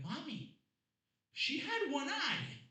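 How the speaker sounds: high, slow, pleading protest; whiny son. Retold or imitated. Comic retelling his reply to his mother, a high pleading whine. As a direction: plead with mommy, drawn out. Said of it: "be like".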